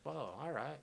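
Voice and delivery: very soft, drained acted reaction; stoned mumble. Smoker settling back in the chair, hushed and mellow. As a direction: let it out quietly, as if sunk back. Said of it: "sit back in the chair and kinda just".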